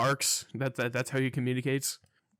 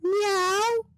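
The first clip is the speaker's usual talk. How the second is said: imitated cat meow; 7 dB louder than usual. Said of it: "And then he goes".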